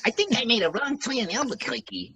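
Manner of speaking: high cartoon rabbit voice with a bouncy wisecrack; Bugs Bunny. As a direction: play the cheeky cartoon rabbit lost on his trip. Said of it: "like Bugs Bunny".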